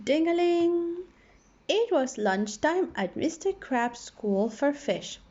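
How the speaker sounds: plain reading voice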